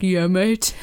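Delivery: a posh North Down "Yeah, mate." rising on mate; teasing a posh schoolboy with a North Down accent. Retold or imitated. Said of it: "Campbell Boys being like".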